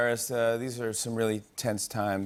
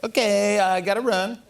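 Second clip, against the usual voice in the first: hurried phone goodbye; 8 semitones higher, 4 dB louder than usual. Retold or imitated. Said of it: "wrap a long call with their parents".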